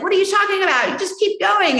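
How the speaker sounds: imitating a husband's high-pitched, incredulous pep talk; incredulous encouragement. Husband urging his wife not to quit, incredulous encouragement. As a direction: brush off the doubts, pitched high.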